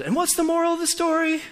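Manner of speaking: imitating a singer speaking the moral with a high voice. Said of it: "I remember him emphasizing the word "do".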